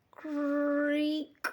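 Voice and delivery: put-on drawn-out creak like an old gate; creaky gate sound. A narrator's reading. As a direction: stretch the creak out slowly.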